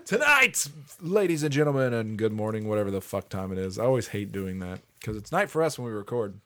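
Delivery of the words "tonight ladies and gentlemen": high, ringing opening call, then settling; mock show announcer. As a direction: announce it to the crowd.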